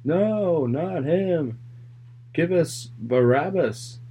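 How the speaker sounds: acted shout of refusal; crowd shouting back. A narrator's reading. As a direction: call it out like a protesting crowd, drawing out the last words. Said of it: "They shouted back".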